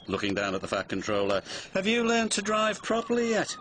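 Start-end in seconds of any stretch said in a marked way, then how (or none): 1.7-3.6 high, disdainful questioning; haughty lorry. A narrator's reading. Elizabeth taunts the Fat Controller about driving, haughty.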